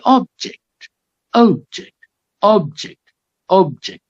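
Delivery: OB-ject, with OB high and -ject dropping; stress on first syllable. Shown as a demonstration. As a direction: hit a clear OB at the start of "object". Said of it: "the stress falls on the first syllable".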